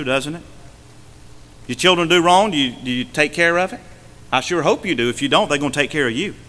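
ordinary talk

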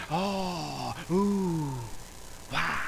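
soft, drawn-out oohing; hushed, put-on wonder; mock awe. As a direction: imitate a dazzled congregation under the breath. Mimicking an impressed congregation.

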